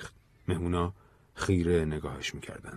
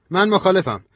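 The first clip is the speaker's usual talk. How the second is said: loud, high-pitched sharp objection; heated dissent, raised voice; harsh, heated; 12 semitones higher, 6 dB louder than usual. A narrator's reading. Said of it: "ویویر با تندی گفت".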